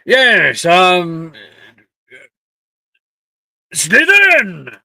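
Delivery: loud, high sorting hat voice with a hesitation; hesitate, then cry out the house name, drawn out; somewhat shaky announcement. A narrator's reading. Just-woken hat sorts a student, shaky loud announcement. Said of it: "it announces somewhat shakily".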